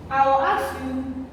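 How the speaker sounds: usual speaking voice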